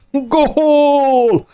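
very high triumphant yell of Gól!; roared. A narrator's reading. Dörmögő úr roars as the ball hits the commander. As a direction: bellow it like a football fan. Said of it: "ordította".

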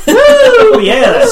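loud high whoop of joy; excited cheer. Real, not acted. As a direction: cheer at the top of the voice. Celebrating excitedly.

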